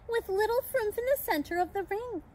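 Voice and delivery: ordinary delivery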